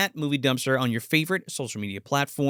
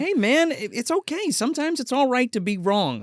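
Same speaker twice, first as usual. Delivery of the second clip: mock condescending; 9 semitones higher than usual. Real, not acted. Teasing cohost about his opinion.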